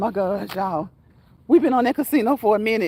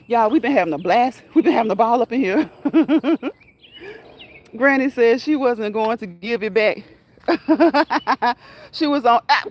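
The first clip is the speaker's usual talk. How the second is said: usual speaking voice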